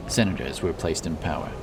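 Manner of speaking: ordinary delivery, nothing marked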